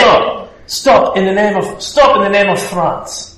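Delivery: put-on loud outraged Frenchman in a comic French accent. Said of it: "talk in a French accent".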